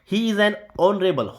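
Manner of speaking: slow, careful example sentence; carefully spaced teaching read. Shown as a demonstration. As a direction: read the example slowly for students. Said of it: "honorable नहीं".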